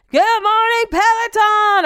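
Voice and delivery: high, loud, bright-eyed fitness instructor welcome; mock instructor greeting. Mocking the Peloton instructor to her listeners. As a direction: ham up the cheery spin coach.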